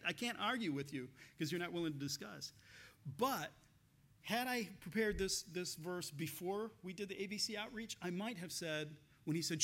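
ordinary talk, nothing marked

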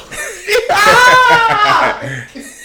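shrieking laugh; loud high cackle of laughter; loud laughing fit. Real, not acted. Huge laugh, loud and far.

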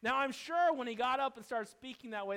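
loud, forceful pulpit preaching; a preacher raising his voice at the congregation; fiery preaching. Acted. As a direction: thunder it from the pulpit. Preaching at full force again.

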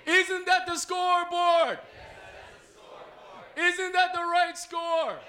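very loud, high, slow cheer; cheerleader shout. Shown as a demonstration. Playing cheerleader, leads the audience in response, shouted chant. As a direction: bellow it slowly so the crowd answers. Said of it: "I'll be the cheerleader".

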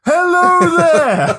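high, slow, loud hello; booming grim reaper voice. Pretend. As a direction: stretch it out in a booming call. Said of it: "I'll speak up then".

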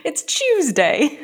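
mock British accent. Co-host adding a British word, mock accent. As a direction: drag it out in silly British. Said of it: "Say it in a British accent".

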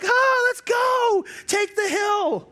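high, slow mock war shout; rally the troops, hammed up; mock charge up the hill; charging battle cry. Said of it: "We're like".

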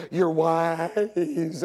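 "ways" stretched the Southern way; mock Southern accent. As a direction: imitate her Southern drawl on "ways". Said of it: "Southern accent".

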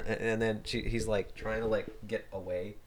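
words fading to near silence; trailing off. Real, not acted. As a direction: let the voice fade out. Voice fades while telling.

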